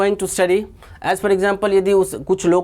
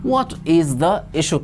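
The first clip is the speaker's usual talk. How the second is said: slow whole phrase; much slower than usual. Shown as a demonstration. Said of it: "उन्होंने पूछा".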